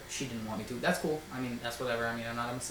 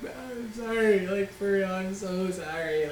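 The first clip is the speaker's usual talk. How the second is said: teary apology; 6 semitones higher, slower than usual. Retold or imitated. Almost in tears apologising.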